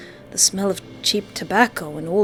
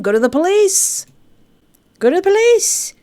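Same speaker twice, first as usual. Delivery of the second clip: high, loud frantic cry; panicked shout; 11 semitones higher, 6 dB louder than usual. Acted. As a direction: yell it in rising panic.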